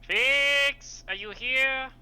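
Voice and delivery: loud high call across the room. Acted. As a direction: shout to find someone in the house. Calling out for Fix.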